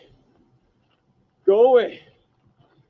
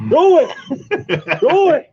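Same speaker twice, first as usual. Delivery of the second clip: panicked shout; 7 semitones higher, 3 dB louder, much faster than usual. Real, not acted.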